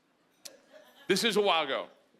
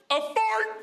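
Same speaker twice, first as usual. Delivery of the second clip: high excited "A fart!"; spring it like a kid's joke, pitched up; gleeful reveal; 14 semitones higher than usual. Retold or imitated. Dad reveals the punchline to the crowd, high and gleeful.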